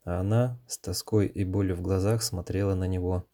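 plain reading voice

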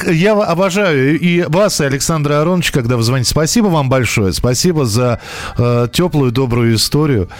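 usual speaking voice

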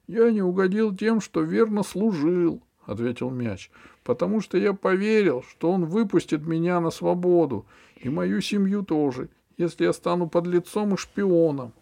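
high, earnest little creature voice; ball character voice. A narrator's reading. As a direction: voice a wronged, bitter ball.

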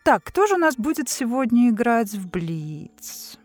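ordinary talk, nothing marked

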